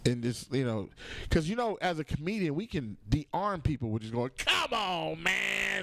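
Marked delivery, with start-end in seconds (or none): 4.4-5.8 high, drawn-out comic plea; hammed-up protest. Comedian shows how he disarms people, high comic protest. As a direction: whine it high to disarm someone.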